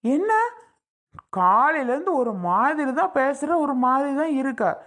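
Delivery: high-pitched, exasperated scolding; fed-up husband's heated outburst; angry threat. Acted. Husband threatens a slap in quarrel.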